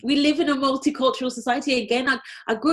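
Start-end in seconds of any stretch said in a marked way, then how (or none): none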